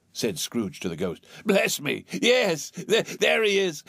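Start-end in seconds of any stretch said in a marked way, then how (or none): 1.4-3.8 high, stammering excitement; thrilled recognition. A narrator's reading. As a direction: exclaim with joy at spotting a lost friend. Scrooge thrilled to see Dick.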